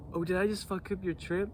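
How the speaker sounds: lightly voiced taunting partner; mocking taunt. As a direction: needle him like a smug coworker. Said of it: "he wasn't even like that".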